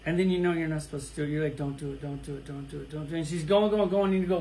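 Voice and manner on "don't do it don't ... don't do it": much softer, under the breath, a self-warning; inner warning voice. Retold or imitated. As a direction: talk yourself down under your breath. Inner voice holding himself back.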